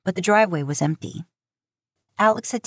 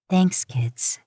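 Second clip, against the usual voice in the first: whispered thanks through tears; teary whisper. Acted. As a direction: breathe the thanks into the hug.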